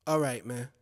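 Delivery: low, soft, deflated reply; sorrowful. Acted. Joe ending the call with Lyor Cohen, sorrowful. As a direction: let the voice sink, disappointed and quiet. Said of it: "I sorrowfully said".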